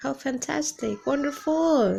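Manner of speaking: high, loud and delighted; enthusiastic praise. Shown as a demonstration. Teacher demoing a thrilled reply to good news. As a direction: sound thrilled for them. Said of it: "you can say".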